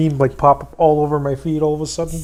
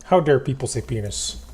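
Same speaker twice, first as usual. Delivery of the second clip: ordinary talk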